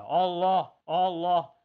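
loud, ringing call of Allah; booming Allah, Allah; loud majestic dhikr. Shown as a demonstration. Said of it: "celali zikir".

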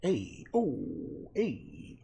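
understated Italian-style calls; mock Italian. Painter absently repeats the bit, light mock Italian. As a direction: idly mimic Italian interjections. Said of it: "that Italian kind".

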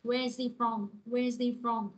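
"where is he from" joined as "where-zee from"; linked, silent H. Shown as a demonstration. Teacher modelling the dropped H for the class, "where is he from" with "he" as "ee". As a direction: leave out the H in "he", linking it to "is". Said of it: "nó sẽ bỏ chữ hờ luôn ha".

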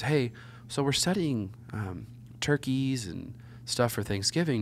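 a hint of a polite schoolteacher, drawn out; imitating the teacher.

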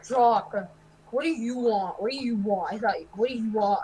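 ordinary talk

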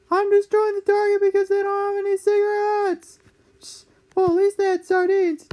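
high, squeaky grumpy dad; whiny high-pitched old man rant; cranky old dad voice. Acted. Dad puppet voice throughout.

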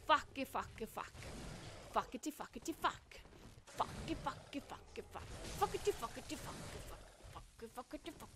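low, soft patter fading to a whisper; rhythmic muttered swearing. Real, not acted. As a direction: chant it under your breath. Swearing under breath in rhythm.

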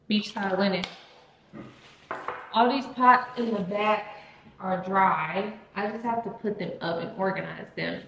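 ordinary talk, nothing marked

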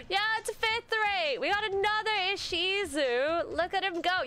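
surprised and thrilled at a new photo; excited surprise. Real, not acted. Host spotting another Ishizu cosplay, high delighted surprise. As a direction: gasp then high delighted exclaiming.

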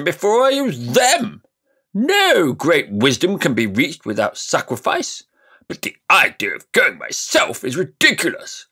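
high, loud, self-important magician; pompous old uncle. Acted. Uncle lecturing the nephew, high and pompous. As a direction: puff yourself up as the great scholar.

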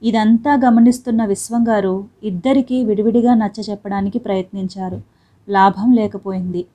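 ordinary delivery, nothing marked

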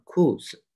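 裤子 with stressed 裤 and light 子; neutral-tone demo. Shown as a demonstration. Teacher repeats for students, 裤子 with 子 in neutral tone. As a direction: say 子 short and unstressed after 裤 in 裤子. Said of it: "就轻一声啊".

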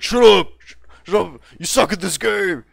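put-on high, loud kid screaming at an opponent; mock yell. Young gamer yelling at another player, high loud squeal. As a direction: yell it like a furious preteen gamer. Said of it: "just us yelling at each other".

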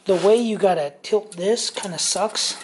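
ordinary talk

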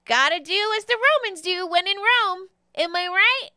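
high, loud pixie patter; Jeremy the pixie. Acted. Pixie coaxes a newcomer with the potion, high and loud. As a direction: toss it out high and jaunty. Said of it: "and says".

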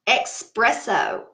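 "espresso" said slowly as "ekspresso", with an x sound; country pronunciation. Shown as a demonstration. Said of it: "I say ex".

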